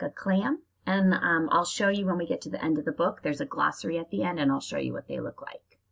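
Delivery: usual speaking voice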